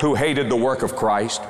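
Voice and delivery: usual speaking voice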